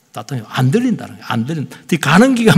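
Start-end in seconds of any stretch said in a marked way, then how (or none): 0.1-0.5 답답해요 said softly, short and low; quiet complaint. Retold or imitated. Congregation complaining about the sermon, 답답해요 said softly. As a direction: murmur 답답해요 like a listener's quiet grumble. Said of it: "답답하다는 거예요".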